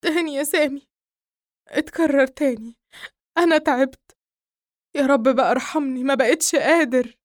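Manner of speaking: high, loud tearful pleading; crying. Acted. Nael telling his friend the nightmare returned, crying. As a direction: break down in weary despair. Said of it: "نائل باكيا".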